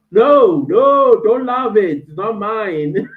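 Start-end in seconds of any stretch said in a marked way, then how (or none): 0.1-2.1 high, loud no no; playful loud objection; loud protest. Real, not acted. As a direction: shout the denial playfully. Reacting to chat compliment.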